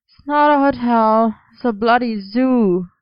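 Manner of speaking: slow sleepy grumble; grumbling. Acted. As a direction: grumble it, worn out. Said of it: "Merlin grumbled".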